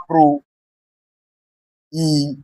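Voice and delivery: "pro, E" as separate syllables with a gap; split into syllables. Shown as a demonstration. Teacher putting word into syllables, syllable by syllable. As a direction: sound out "pro", pause, then "E". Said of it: "put this word into syllable".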